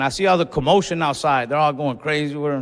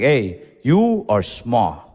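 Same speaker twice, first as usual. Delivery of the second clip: low, slow mock-solemn elder; lightly acted grandparent stating a hard truth; grandparents' blunt warning; 5 semitones lower, much slower than usual. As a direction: break it to them slowly and gravely. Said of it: "They would always tell me".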